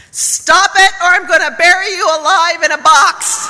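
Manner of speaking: loud, mock-fierce punchline threat; forceful threat. Therapist threatening his client with a loud mock-fierce punchline. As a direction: belt it out with all he can muster. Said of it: "with all that he could muster".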